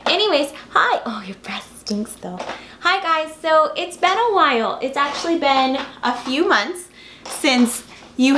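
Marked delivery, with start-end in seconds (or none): 1.0-2.4 hushed whisper to the dog; whispered aside. Real, not acted. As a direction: whisper it under your breath.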